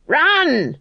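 high urgent yell; sudden sharp cry of alarm. Acted. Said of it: "she yelled".

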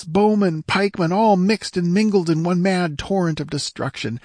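high, distressed report of the rout; sobbing squire. A narrator's reading. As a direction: report defeat as if near tears. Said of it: "sobbed the squire".

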